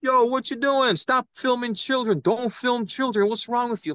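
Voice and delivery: high-pitched mock scolding yell; retold yelling. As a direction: imitate someone angrily ordering a person to stop. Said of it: "start yelling and screaming".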